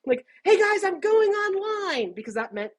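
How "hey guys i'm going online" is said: high, drawn-out call to the room; mock shout. Intern warning the office about the phone line, mock shouting. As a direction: act out calling out slowly and high. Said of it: "you'd have to shout".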